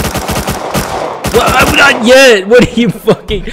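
high, loud, incredulous protest; outraged shout. Real, not acted. Player protests being hit too early, outraged shout. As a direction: shout it in disbelief.

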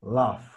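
teacher models laugh, slow, ending in a clear F. Shown as a demonstration. Teacher models laugh for students, slow clear F. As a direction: laugh said slowly, final F clear. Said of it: "tenemos que decir laugh".

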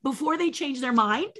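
high, loud, breathless-with-joy plea; giddy urgency. Retold or imitated. Puppeteer begging to sign before they change their minds, giddy. Eager to secure the surprise contract.

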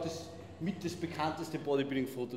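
usual speaking voice

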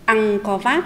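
"Angkor Wat" said slowly and clearly, "Wat" ending in the "át" rhyme; teacher demo of "Angkor Wat" with a clear "át" ending; model pronunciation. Shown as a demonstration. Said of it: "Các em hãy đọc theo cô".

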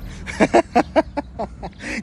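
breathy, voiceless cackle; laugh. Real, not acted. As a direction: laugh until the voice gives out. Laughs at own joke.

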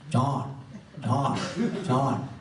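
put-on Bengali-accented "chant", each one separate; heavy Bengali accent. Teacher telling people to chant, imitated Bengali accent. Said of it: "he had a very heavy Bengali accent".

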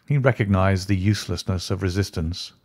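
ordinary delivery, nothing marked

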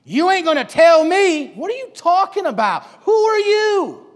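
loud, high, drawn-out indignation; defiant outburst. Acted. Girl defying a person in authority, loud indignant outburst. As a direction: challenge authority with a rising shout. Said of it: "you give a look to them".

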